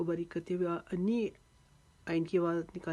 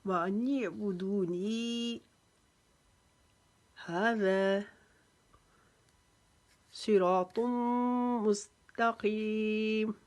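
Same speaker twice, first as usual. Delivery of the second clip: slow, drawn-out Quranic chant; full recitation; 3 semitones higher, 3 dB louder, much slower than usual. Shown as a demonstration. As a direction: recite the verse with pauses. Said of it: "इस आयत को वक्फ़े के साथ पढ़ते हैं".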